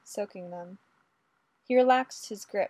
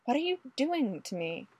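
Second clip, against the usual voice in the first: higher, indignant hushed protest; furious whisper; 5 semitones higher than usual. A narrator's reading. Ray being pushed into the corner, furiously whispered. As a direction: protest in an urgent stage whisper. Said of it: "Ray whispered furiously".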